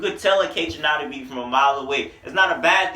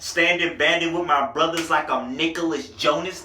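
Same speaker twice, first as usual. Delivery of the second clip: usual speaking voice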